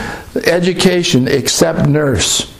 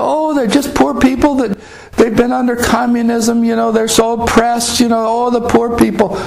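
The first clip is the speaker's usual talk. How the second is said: pitying; 7 semitones higher than usual. Retold or imitated. Naive pity for the oppressed.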